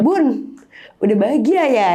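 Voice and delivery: a retold quiver on "Bun, udah bahagia ya?", pitched a little up; voice shaking. Daughter to her dead mother, a mild retold tremble. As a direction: speak to the late mother as the voice starts to tremble. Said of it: "shaking tiba-tiba".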